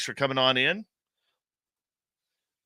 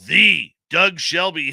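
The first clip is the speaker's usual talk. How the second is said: The drawn out long and louder; stress on The; 5 semitones higher, 8 dB louder, slower than usual. Real, not acted. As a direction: hit a long, emphatic The before the name. Mock-grand arrival of a regular.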